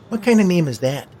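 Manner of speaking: put-on high, loud little-girl incredulity; high-voiced kid, loudly puzzled. A narrator's reading. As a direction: pitch it up like a cheeky child questioning a name. Little girl mocks boy's name.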